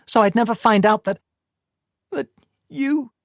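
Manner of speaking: high, rising accusation; accusing woman. Acted. Tamsin accusing Paul.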